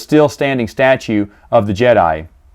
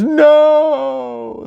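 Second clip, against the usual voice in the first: much higher cry of no; anguished no; 13 semitones higher than usual. Retold or imitated. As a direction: cry out in dismay. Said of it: "And he goes".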